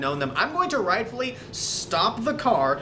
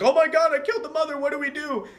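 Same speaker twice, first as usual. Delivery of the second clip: mock panic; 9 semitones higher than usual. Cop who killed the mother asks for help, mock panic. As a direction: act out a cop panicking, pitch up.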